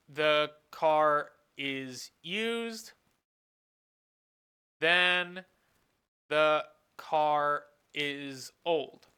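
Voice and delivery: very slow, word by word, with long pauses; slow, deliberate phrasing like a teacher dictating a rule; slow dictation. Shown as a demonstration. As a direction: spell it out slowly as you write it on the board. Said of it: "Which we could kind of rephrase as".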